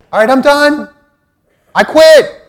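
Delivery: loud, high cry of giving up; exasperated outburst. Acted. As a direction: throw up your hands and shout it to God. Acted prayer of giving up.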